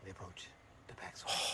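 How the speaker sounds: hushed golf announcer whisper; golf commentator. Acted. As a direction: breathe the play call like TV golf. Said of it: "We're gonna do the whole golf guy thing".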